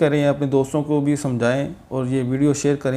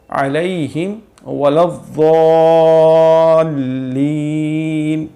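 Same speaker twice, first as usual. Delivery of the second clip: Quran recitation; 3 dB louder, much slower than usual. Acted.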